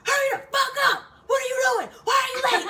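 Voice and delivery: full high-pitched shout; imitated furious screaming order; drill sergeant yelling. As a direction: berate a straggling recruit at top volume. Sergeant yelling as she returns late.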